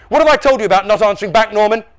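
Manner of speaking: fast, high, loud telling-off; angry scolding. A narrator's reading. Dad catching Norm answering back, angry. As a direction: rattle out a dad's rebuke.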